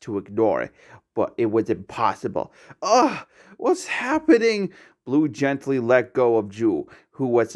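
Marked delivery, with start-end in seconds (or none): 2.8-4.7 high strained cry of pain. A narrator's reading. Blue struck by pain in his back, crying out. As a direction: gasp out the question in pain.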